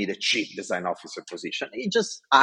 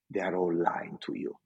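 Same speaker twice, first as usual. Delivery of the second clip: a hint of a stern boss; serious boss; 4 semitones lower, 6 dB quieter than usual. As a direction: lower and softer, flat verdict. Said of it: "he was always very serious".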